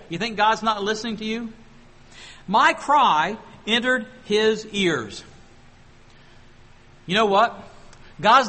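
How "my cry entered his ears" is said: slow, louder proclamation; slow emphatic preaching; emphatic slow reading. Shown as a demonstration. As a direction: lean on each word of the verse.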